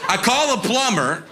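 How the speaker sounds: ordinary talk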